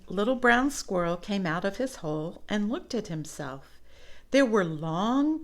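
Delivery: plain reading voice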